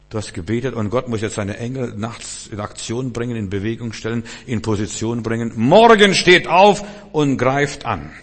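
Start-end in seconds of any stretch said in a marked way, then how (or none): 5.6-8.1 loud, slow commanding proclamation; God's battle order. Acted. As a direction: call the troops to attack, drawn out. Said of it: "dann sagt Gott". (lauter, gedehnter Befehl Gottes)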